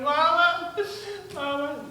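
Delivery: mock-bawl like an upset child; crying little kid. Seven-year-old runs home to mama, crying like a baby. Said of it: "he's crying like a baby".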